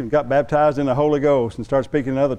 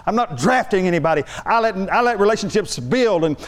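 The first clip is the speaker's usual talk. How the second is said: higher-pitched, worked-up complaint; indignant rant; 4 semitones higher than usual. Retold or imitated. Husband venting to his wife about the pastor's order, indignant. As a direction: vent your outrage, building as you go.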